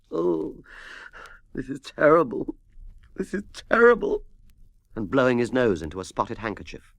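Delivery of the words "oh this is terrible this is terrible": tearful despair of a ruined manager; weeping. A narrator's reading. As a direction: moan it out between sobs. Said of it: "weeping and muttering to himself".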